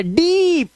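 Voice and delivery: डीप with a high, drawn-out "eee"; long vowel. Shown as a demonstration. Teacher modelling double e for pupils, long vowel. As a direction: stretch the middle of डीप. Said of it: "ये long vowel sound है".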